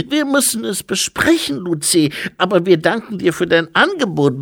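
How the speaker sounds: very high, loud infant voice; squeaky toddler speechifying loudly; baby-like abbot. A narrator's reading. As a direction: play a dignified abbot in a baby's piping voice.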